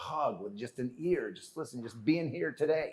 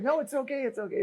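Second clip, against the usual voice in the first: higher, flustered sister protesting; imitating his sister; 10 semitones higher than usual. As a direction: mimic her awkward refusal. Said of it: "my poor sister's like".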